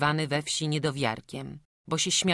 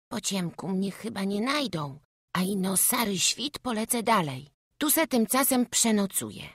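put-on higher-pitched child's voice; plucky little boy; 7 semitones higher than usual. Runaway boy plans to sleep hidden till dawn, high child's voice. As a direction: think aloud as a country boy. Boy planning his night.

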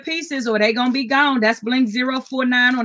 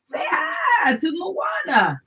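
high, slow call across the room; 8 semitones higher, 4 dB quieter, slower than usual. Real, not acted. Grandma calls to her grandson, high and drawn out. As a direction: sing out to the little one.

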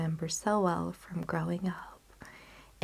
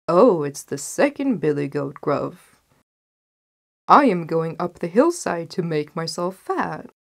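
louder middle-sized billy goat; not-so-small goat voice; 8 dB louder than usual. A narrator's reading. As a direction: answer as the sturdier second goat. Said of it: "who hadn't such a small voice".

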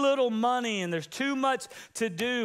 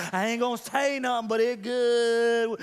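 sly hint. Acted. Pastor hinting at his marriage.

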